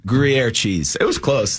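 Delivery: ordinary talk, nothing marked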